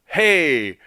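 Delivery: tag of mock self-talk. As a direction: play at alerting yourself out loud. Mocks over-conscious clicking thoughts.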